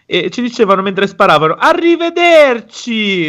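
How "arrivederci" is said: very high, slow, drawn-out sing-song farewell; mock Italian farewell. Imitating Italian NPCs while shooting.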